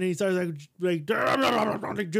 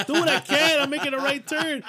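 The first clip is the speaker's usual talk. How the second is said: flustered; 7 semitones higher, 4 dB louder than usual. Retold or imitated. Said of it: "I'm like".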